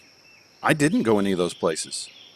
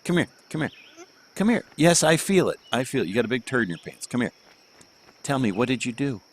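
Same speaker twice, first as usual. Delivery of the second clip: higher, sing-song voice for a little one; 6 semitones higher than usual. Real, not acted. Father talks to his toddler.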